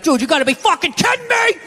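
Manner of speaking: high, outraged sports-fan yell; screaming at ref. Retold or imitated. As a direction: yell at the referee on TV. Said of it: "you're screaming at the ref".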